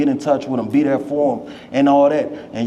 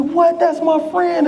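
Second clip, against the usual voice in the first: shocked squeal; slower than usual. Retold or imitated. Valedictorian shocked at a party, very high surprised cry.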